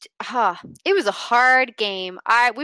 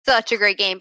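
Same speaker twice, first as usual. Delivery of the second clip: normal talking voice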